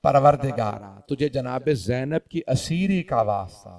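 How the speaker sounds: low, soft supplication; subdued, low-voiced dua; closing prayer. Real, not acted. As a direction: bring it right down and pray humbly.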